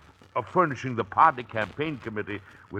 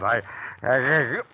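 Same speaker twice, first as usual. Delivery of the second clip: about to sneeze; 2 semitones higher than usual. Acted. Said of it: "Bless you".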